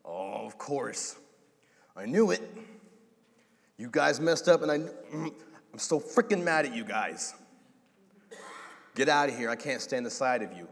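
an exploding, fed-up father; angry parent. Retold or imitated. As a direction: yell it like a parent who's had enough. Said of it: "Like an angry parent".

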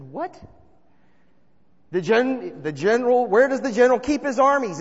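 loud, high disbelieving repeat; incredulous. Retold or imitated. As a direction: throw the question back in disbelief. Incredulous retort to brother.

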